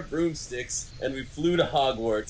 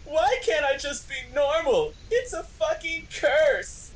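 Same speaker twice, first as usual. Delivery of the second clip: mock angry shout; 13 semitones higher than usual. Heroine rails at her own beauty, hammed-up. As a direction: wail it like a teen tragedy. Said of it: "I shouted".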